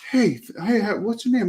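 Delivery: high, eager friendly greeting; friendly salesman. Retold or imitated. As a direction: greet him like a keen seller.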